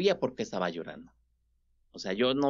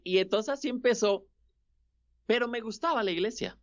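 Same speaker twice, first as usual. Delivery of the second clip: ordinary talk, nothing marked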